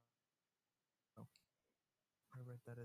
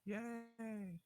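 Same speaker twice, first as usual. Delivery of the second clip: excited cheer; 11 semitones higher than usual. Real, not acted. Cheering at anniversary news.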